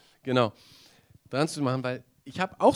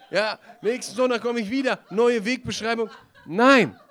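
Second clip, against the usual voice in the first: laughing; 9 semitones higher, 9 dB louder than usual. Real, not acted. Laughing at own parody.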